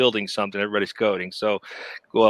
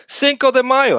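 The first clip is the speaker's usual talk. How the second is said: higher, louder burst of delight; excited exclamation; 12 semitones higher, 5 dB louder than usual. Real, not acted. Host suddenly remembering the holiday, excited. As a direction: announce the holiday with glee.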